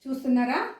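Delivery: ordinary delivery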